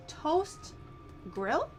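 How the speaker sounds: ordinary delivery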